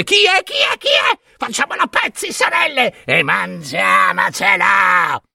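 very high, shrieking angry crones; furious hags. A narrator's reading. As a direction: screech in fury like wild witches. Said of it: "gridare infuriate".

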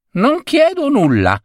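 high piping cricket voice. Acted. Cricket warning the husband in a high voice. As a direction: pipe it up high like a tiny creature.

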